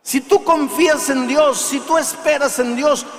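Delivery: preaching at full force, loud and high; predicación enérgica. Acted. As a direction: thunder it at the congregation. (predicador a plena voz)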